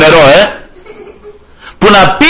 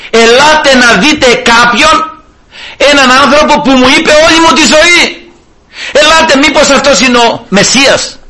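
eager call to the whole town, raised; excited calling out; 6 semitones higher than usual. Acted. Woman calling the people of Samaria to come, excited. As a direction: call them to come see, rising to a shout.

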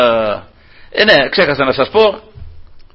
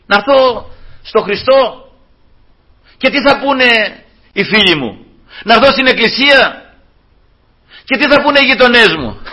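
hesitant believer; 6 semitones higher than usual. Retold or imitated. A doubter fretting over friends and neighbours, voiced higher. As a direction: ask it like someone afraid of the neighbours.

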